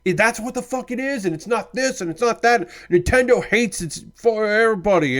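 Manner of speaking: high-pitched rant mocking angry internet complainers; mock outrage. Streamer mimicking angry people online, mock-outraged whine. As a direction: imitate an indignant whiner, pitched well up. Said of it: "people being like".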